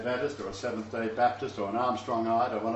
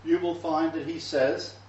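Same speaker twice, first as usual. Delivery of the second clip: ordinary talk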